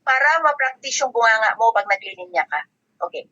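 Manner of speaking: ordinary talk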